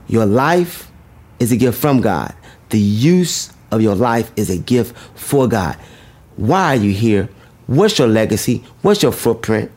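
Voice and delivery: normal talking voice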